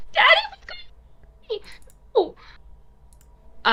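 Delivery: put-on small child whimpering in fear; frightened child. Child watching her father being choked, frightened.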